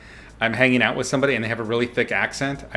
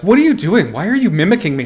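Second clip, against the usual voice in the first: high, loud imitation of an offended accuser; mock indignant accusation; 7 semitones higher, 7 dB louder, faster than usual. Offended person confronting the streamer for copying their accent, mock indignant. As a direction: call out a mimic in mock indignation, pitched up and loud. Retells being called out for mimicking.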